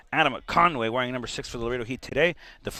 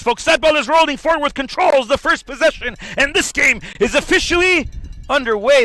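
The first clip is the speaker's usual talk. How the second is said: loud, high play-by-play burst; excited kickoff call; 13 semitones higher, 10 dB louder than usual. Acted.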